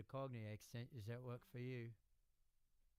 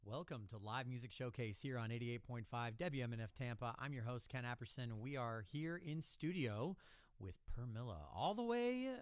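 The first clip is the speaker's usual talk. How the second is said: louder, upbeat radio host intro; radio announcer; 8 dB louder than usual. Real, not acted. Host introducing the band on the radio, announcer voice. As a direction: welcome the listeners like a live DJ.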